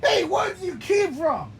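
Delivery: loud, high crying yell; startled shout. Acted. Mister Johnson startled by a sudden arrival, shouted. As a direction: yell it as if caught off guard.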